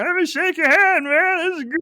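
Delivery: put-on high, loud drunken enthusiasm; Lenny. Co-host imitating the tipsy employee wanting a handshake, drunk gushing. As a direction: play a harmless, overfriendly drunk. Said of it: "even though he's drunk".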